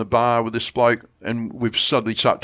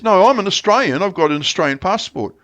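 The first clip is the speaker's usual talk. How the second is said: higher and louder, defensive; insistent protest; 8 semitones higher, 3 dB louder than usual. Retold or imitated. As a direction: dig in and insist on it. Bloke insists he's Australian.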